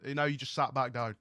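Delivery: ordinary delivery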